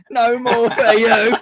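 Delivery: high, loud drawn-out mock cry, laughing; mock outburst. Teasing a friend who jumps in, loud laughing mock cry. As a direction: wail it slowly through laughter. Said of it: "He just jumps in and goes".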